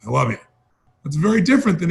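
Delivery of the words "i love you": bored. Shown as a demonstration. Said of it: "and you're bored".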